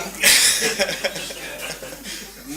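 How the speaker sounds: a big burst of real laughter; loud laugh. Real, not acted. Laughing at the Alan Sugar photo.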